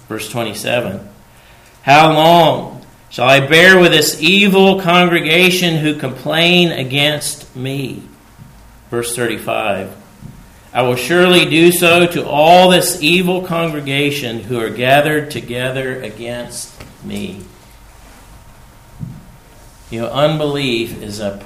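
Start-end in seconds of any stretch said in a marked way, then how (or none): 1.8-8.0 booming scripture reading. Acted. Reading God's words aloud. 10.7-17.4 booming scripture reading. Acted. Reading God's words aloud.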